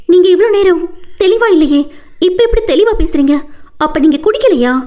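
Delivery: lightly voiced wife pushing back; wife's voice. A narrator's reading.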